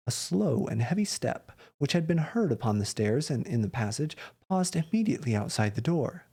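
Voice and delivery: plain reading voice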